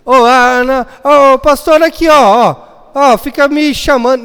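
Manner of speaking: loud, high tattling call; whiny tattling. Retold or imitated. Teen complains to the pastor about being kicked, loud and whiny.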